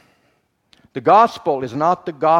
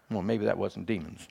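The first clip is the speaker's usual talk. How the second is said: sheepish aside; 6 semitones lower, 11 dB quieter than usual. Retold or imitated. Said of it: "And I went".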